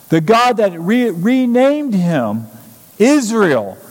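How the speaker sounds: high, driving preacher's cadence, building; impassioned preaching. Real, not acted. Preacher urging the congregation about Jacob's God, impassioned preaching. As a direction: preach it hard, pitch climbing on each phrase.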